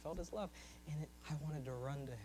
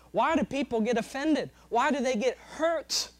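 loud, high, impassioned sermon delivery; full-force preaching; 8 semitones higher, 10 dB louder than usual. Acted. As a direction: preach it hard to the congregation.

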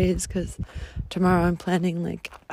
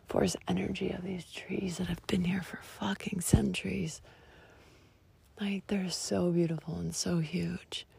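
awestruck whisper; 9 dB quieter than usual. Real, not acted. Among centuries-old trees, awed whisper. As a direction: breathe it out in awe.

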